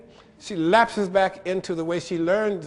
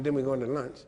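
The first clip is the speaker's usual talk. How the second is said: ordinary talk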